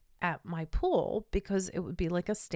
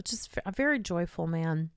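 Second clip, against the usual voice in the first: ordinary talk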